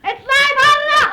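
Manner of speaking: furious order out; angry shout to leave. Acted. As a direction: throw her out of the house. Woman throws her out.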